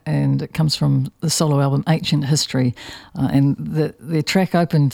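usual speaking voice

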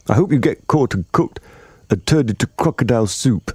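high-pitched, scolding curse; indignant cry. A narrator's reading. Humpy Rumpy wishing ill on the crocodile, indignant. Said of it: "cried Humpy Rumpy".

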